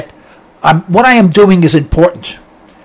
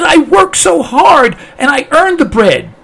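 high-pitched, aggrieved hen voice; hammed-up little protest; 9 semitones higher than usual. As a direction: plead your case in a high voice. Hen protests to Farmer Obama.

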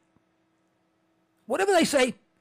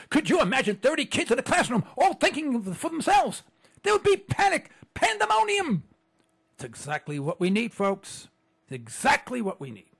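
normal talking voice

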